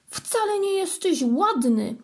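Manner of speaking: 화난 고양이의 높은 외침; called out. A narrator's reading. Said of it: "zawołał".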